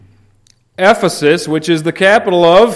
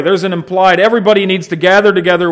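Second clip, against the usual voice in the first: ordinary talk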